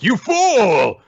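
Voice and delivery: hammed-up scornful yell; villain's scornful shout. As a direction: spit out contempt like a cartoon villain. Alien villain sneers.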